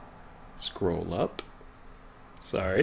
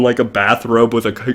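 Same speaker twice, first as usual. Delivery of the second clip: normal talking voice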